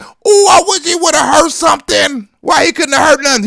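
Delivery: high shouted mock wish. Comedian mocking what everybody wished about the president, in a loud hammed-up voice. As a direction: shout it like gleeful gossip. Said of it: "everybody was like".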